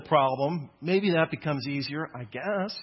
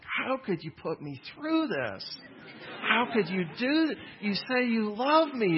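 put-on hurt baby voice; 5 semitones higher than usual. Baby's betrayed plea voiced.

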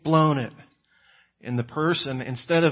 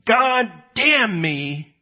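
mock curse; 6 semitones higher, 5 dB louder, much slower than usual. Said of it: "to curse in this culture".

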